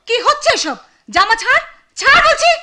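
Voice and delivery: high-pitched, loud scolding; sharp, outraged command; angry shout. Acted. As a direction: shout to break up a fight. Sister breaks up the fight.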